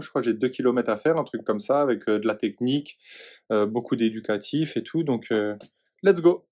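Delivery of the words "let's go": hyped up. Real, not acted. Hyped start before the swim.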